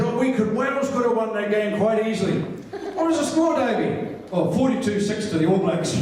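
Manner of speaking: chirpy mimicry of a mate calling; imitating Davey. Davey voiced in a dialogue.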